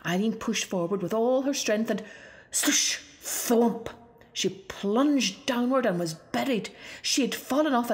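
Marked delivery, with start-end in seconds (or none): none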